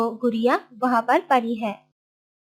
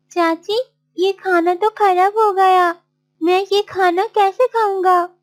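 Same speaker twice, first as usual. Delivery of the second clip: higher-pitched protest; dismayed Martin; 7 semitones higher, 3 dB louder than usual. Acted. Martin shown spoiled food by aunt, dismayed. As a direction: object helplessly in Martin's raised voice.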